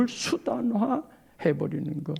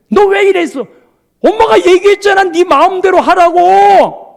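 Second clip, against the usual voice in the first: higher and louder, sharp back-and-forth; heated quarrel; 10 semitones higher, 13 dB louder than usual. Retold or imitated. Mother and child quarrel over the child's choice, loudly. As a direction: argue it out at full pitch.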